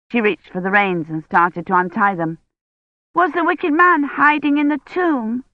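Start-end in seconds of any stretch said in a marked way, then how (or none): 3.1-5.4 put-on higher, curious child voice; a wide-eyed little girl asking; curious child asking Kivrin; little girl Agnes. A narrator's reading.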